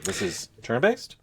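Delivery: usual speaking voice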